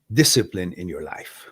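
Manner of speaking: usual speaking voice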